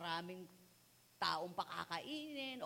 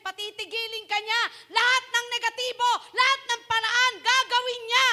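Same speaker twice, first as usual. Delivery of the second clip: loud, high, fervent sermon; thundering sermon; preaching at full force; 10 semitones higher, 14 dB louder than usual. Acted. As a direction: preach hard, driving every line.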